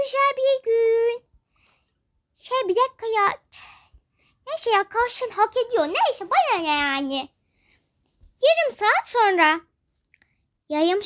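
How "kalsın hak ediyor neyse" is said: ordinary talk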